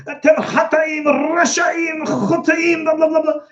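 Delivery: high-pitched mock hellfire tirade; fire-and-brimstone preaching. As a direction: rail at the congregation like a hellfire preacher. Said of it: "real fire and brimstone".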